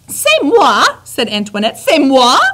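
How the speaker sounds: high, loud, French outraged question; outraged French wife, rising high; indignant French. Acted. Antoinette is blamed, outraged French question high and loud.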